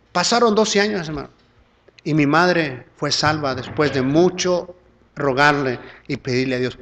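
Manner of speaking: ordinary talk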